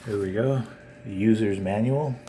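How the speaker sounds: ordinary talk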